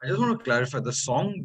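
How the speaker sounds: ordinary talk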